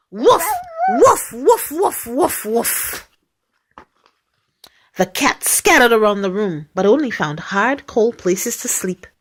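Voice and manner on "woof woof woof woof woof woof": high woofs tailing off; dog barking. Acted. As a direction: bark like a dog chasing cats away. Imitating dog barking the cats away.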